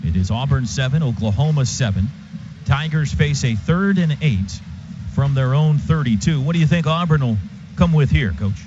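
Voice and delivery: normal talking voice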